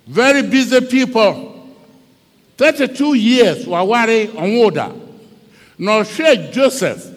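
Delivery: high-powered, forceful sermon shout; full-force preaching. Acted. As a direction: preach it out hard over the crowd.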